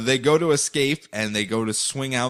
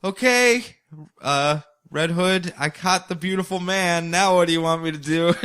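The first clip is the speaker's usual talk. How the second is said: higher, chirpy alien voice; Starfire; 6 semitones higher than usual. Retold or imitated. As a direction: sound cheerfully clueless. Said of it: "Starfire goes".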